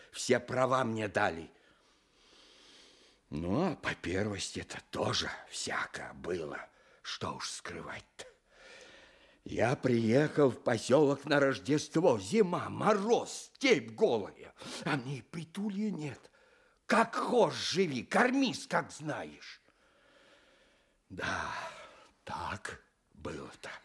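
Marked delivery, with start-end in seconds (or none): 3.3-8.2 whisper. Real, not acted. Quiet confession of hard times. 21.1-23.7 a rueful whisper under the breath; a soft, voiceless sigh of words; whispered aside. Real, not acted.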